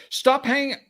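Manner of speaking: high loud mock fury; furious caller. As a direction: play the caller outraged at being hung up on. Voicing the angry caller.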